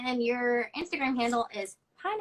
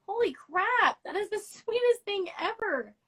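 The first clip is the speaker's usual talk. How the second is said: ordinary talk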